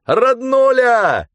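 high, loud, slow drawn-out yell; joyful shout. Acted. Mitka shouts joyfully as he dives into the waves. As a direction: whoop it before the plunge. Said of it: "орал Митька".

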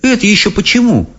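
put-on high, surprised girl voice. A narrator's reading. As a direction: ask in girlish surprise. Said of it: "удивилась Софи". (удивлённо, детским голосом)

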